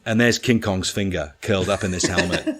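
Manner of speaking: usual speaking voice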